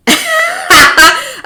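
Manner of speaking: sudden high laugh; high burst of laughter. Real, not acted. Streamer laughing at a viewer's comment, high laugh.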